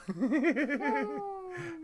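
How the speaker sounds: long, high-pitched giggling; high giggle. Real, not acted. Co-host laughing at host's teasing, high giggle.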